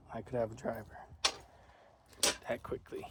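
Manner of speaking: breathless, airy trailing off; winded after pushing the cart; out of breath. Real, not acted. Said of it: "I'm out of breath".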